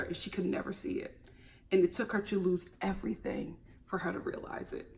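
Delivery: soft unvoiced whisper. Real, not acted. Telling viewers the sad truth, whispering. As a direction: drop to a whisper for the sad part. Sad point said under breath.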